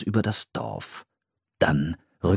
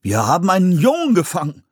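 louder astonished announcement; surprised man; 10 semitones higher, 4 dB louder than usual. A narrator's reading. A settler man announces the catch, surprised. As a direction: say it amazed. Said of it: "stellte ein Mann überrascht fest".

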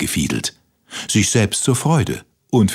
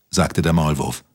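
ordinary delivery

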